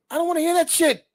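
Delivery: high, loud frustrated outburst. Retold or imitated. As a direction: snap back that you don't want to hear it. Venting about consolation, high shout.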